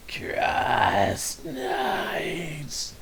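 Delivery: breathy ghostly whisper over static; creepy ghost whisper. Retold or imitated. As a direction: hiss it like a ghost on a bad line. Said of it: "creepy ghost voice over static".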